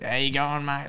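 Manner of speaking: broad Australian greeting; mock Aussie accent. Shown as a demonstration. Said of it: "most generic Aussie".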